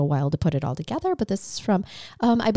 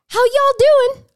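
loud, high Southern greeting; Southern accent; 8 dB louder, slower than usual. Retold or imitated. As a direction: chirp it in a hammed-up Southern accent. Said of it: "Completely in a Southern accent".

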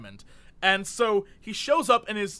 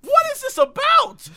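much higher, louder disbelief; what on earth, pitched up; incredulous; 7 dB louder than usual. Real, not acted. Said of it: "incredulous outburst".

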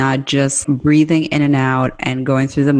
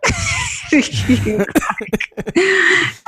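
a burst of high laughter; high giggle. Real, not acted. Cohost laughing at the awkward couch scene.

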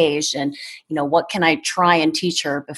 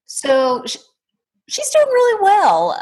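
pitched way up, louder; high, delighted reply; bright excited answer; 4 dB louder than usual. Real, not acted. Mother answers while child appears.

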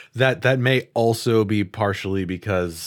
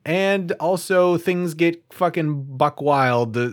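excited; 6 semitones higher than usual. Real, not acted. Hyping the wild tournament.

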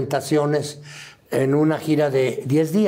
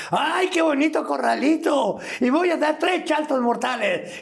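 high, bouncy clown voice; silly clown voice; 9 semitones higher than usual. Retold or imitated. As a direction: play it like a circus clown. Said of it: "empezaba a hablar como payaso".

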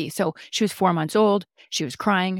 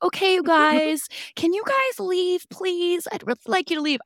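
politely shooing spirits; 12 semitones higher than usual. Retold or imitated. He asks the ghosts to leave, high and polite. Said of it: "'Cause he was probably like".

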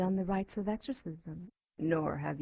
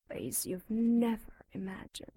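ordinary talk

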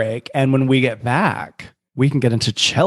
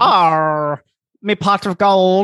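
mock Irish pirate; 5 semitones higher, slower than usual. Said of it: "I sound like a pirate".

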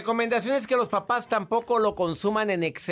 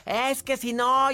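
higher, drawn-out whine of protest, lightly acted; mock child tantrum; 8 semitones higher, slower than usual. Doctor imitates children denied sweets, mock tantrum. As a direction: imitate a child starting to yell, slowly and higher. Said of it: "y empiezan a gritar".

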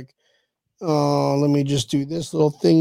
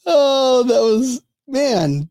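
ordinary delivery

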